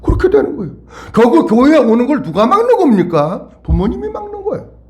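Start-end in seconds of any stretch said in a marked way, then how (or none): none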